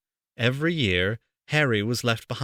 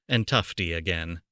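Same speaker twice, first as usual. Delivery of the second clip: ordinary delivery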